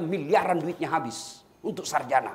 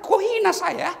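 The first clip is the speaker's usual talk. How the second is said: much higher and louder, indignant; offended rich man; 11 semitones higher, 7 dB louder, slower than usual. Retold or imitated. Preacher mimicking the rich man's indignation, high and loud. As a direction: huff like an insulted official.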